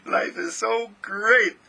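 high, drawn-out elated gushing; loud inner joy. Acted. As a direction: exult to yourself, high and out loud. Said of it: "you whisper it so loud that you just hear it and say".

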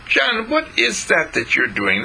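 put-on high girl's voice, curious; curious little girl. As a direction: play six-year-old Jean, nosy. Jean asks curious.

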